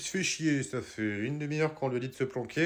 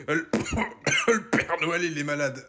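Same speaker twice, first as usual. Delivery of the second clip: ordinary delivery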